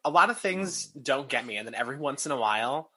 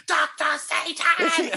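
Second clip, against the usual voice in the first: high, loud and slow, stretched-out scream; screaming excitedly; 7 dB louder, much slower than usual. Retold or imitated. In the haunt, screaming the villain's name with joy. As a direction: scream the name like a thrilled fan. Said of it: "I'm screaming at the top of my lungs".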